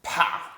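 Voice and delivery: short loud scornful snort; snarled pah. A narrator's reading. Dwarf scoffing at the badger, loud snarl. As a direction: spit the sound out. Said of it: "snarled Nickabrick".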